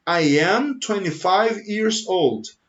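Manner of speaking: slow, careful English model sentence; slow model answer. Shown as a demonstration. Teacher modelling a slow answer for students. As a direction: spell out each word slowly for students. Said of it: "Então, você responde".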